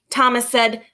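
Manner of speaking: usual speaking voice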